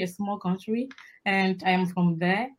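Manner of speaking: normal talking voice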